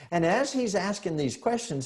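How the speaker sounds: ordinary delivery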